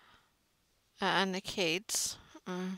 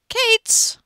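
exasperated shout; 11 dB louder than usual. Real, not acted. Exasperated at the kids, said twice.

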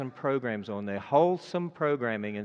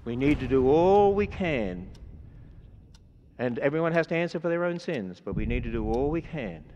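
preaching at full force. Acted. Preacher urging the congregation into the ark, full-force preaching. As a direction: drive the warning home to the congregation.

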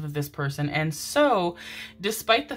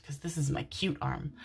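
soft voiceless aside; joking whisper; 2 semitones lower, 8 dB quieter than usual. Real, not acted. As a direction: whisper the joke to yourself.

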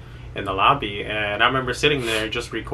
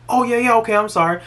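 high, louder, caught-out apology; imitating a nurse caught out, high and flustered; 12 semitones higher, 6 dB louder than usual. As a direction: blurt it like a nurse caught slacking.

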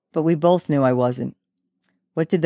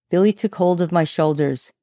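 ordinary delivery, nothing marked

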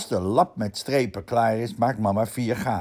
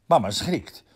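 plain reading voice

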